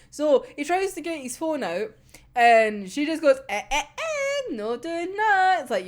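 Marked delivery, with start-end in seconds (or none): 3.5-5.6 teasing scold. Retold or imitated. She stops his phone call.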